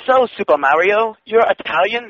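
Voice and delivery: high-pitched mock interviewer with an accent; Donna. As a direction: pitch it up, asking like a simple-minded interviewer. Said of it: "she'd be like".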